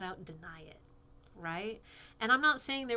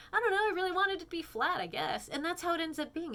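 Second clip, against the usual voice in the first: high mock whine; 10 semitones higher, 5 dB louder than usual. Mock confession of wanting flat earth.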